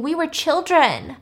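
half-scream, imitating an outraged victim; retold scream. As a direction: cry it out, high but short of a real scream.

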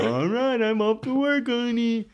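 high, bright mock husband leaving for work; cheery husband voice. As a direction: play the chipper husband heading out. Voicing Azul going to work.

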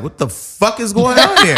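high, laughing disbelief; mock shock. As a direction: play her stunned, cracking up. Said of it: "she was like".

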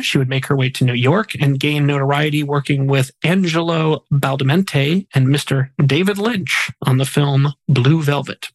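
usual speaking voice

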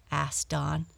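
ordinary delivery

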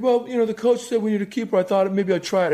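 put-on high young boy voice; kid voice. Son answering dad, innocent kid voice. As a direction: innocent eight-year-old. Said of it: "He's like".

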